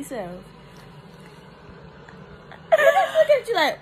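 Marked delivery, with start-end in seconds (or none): 2.7-3.8 high, loud laughing words. Real, not acted. Daughter laughs at her crying mother, high and loud. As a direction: laugh it out at mom.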